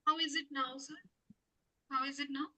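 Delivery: faint, distant voice; asking through a weak mic; barely audible. Real, not acted. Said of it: "Your voice is very low".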